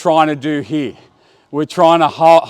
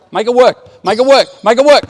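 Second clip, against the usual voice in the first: higher and louder each time, rising to a shout; building urging shout; 6 semitones higher, 5 dB louder than usual. Real, not acted. Coach urging players to speed up, building shout. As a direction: push them harder with each repeat.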